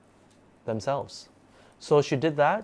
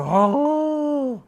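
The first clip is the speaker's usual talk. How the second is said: high drawn-out wolf howl, much higher and louder; imitated howl; 7 dB louder than usual. Imitating the son howling like a wolf. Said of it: "like that, howling".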